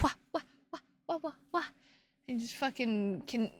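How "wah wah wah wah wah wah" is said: high mock baby wail; mock crying. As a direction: whine wah wah like a crybaby. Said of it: "You're like".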